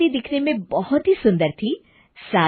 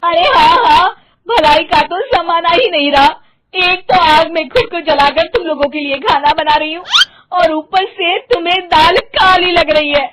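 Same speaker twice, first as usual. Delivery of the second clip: high, loud indignant tirade; shouting; 8 semitones higher, 8 dB louder than usual. Acted. Mother overhearing her husband's suspicion, furious shouting. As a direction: yell like a wronged martyr. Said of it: "जोर-जोर से चिल्लाने लगती है".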